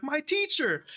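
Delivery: very high, delighted imitation; excited greeting back. Bandmate greeting the fan as teacher, excited.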